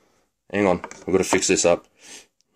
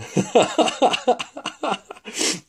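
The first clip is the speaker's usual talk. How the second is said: high staccato cackle; long run of high, quick laughs; 14 semitones higher than usual. Real, not acted. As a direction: cackle at your own joke. Laughs at his own joke.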